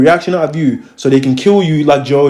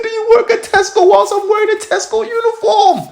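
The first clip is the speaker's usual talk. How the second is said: much higher, squealed incredulous mockery; mocking disbelief. Mocking the officer's silly question, high-pitched disbelief.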